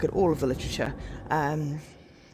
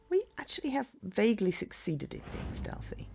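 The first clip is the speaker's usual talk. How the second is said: whisper; 3 semitones higher than usual. Real, not acted. Playing stealthy, hushes voice.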